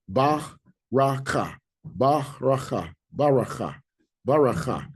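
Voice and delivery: "baraka" as ba-ra-ka, slow and separated first, then joined; repeated teacherly "baraka" in open syllables; pronunciation demo. Shown as a demonstration. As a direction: sound out "baraka" from slow syllables to whole word. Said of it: "You pronounce this one".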